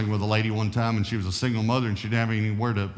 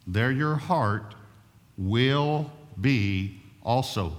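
slow scripture; much slower than usual. Acted. Slow emphatic scripture quote.

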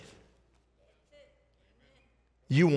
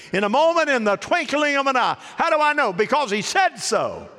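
high, loud preaching; preacher building to full force; preaching climax; 10 semitones higher, 4 dB louder than usual. Real, not acted. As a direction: lift it to the rafters.

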